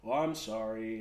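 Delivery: low, throwaway "Oh, I'm sorry"; mock insincere apology; dismissive apology. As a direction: say sorry without meaning it.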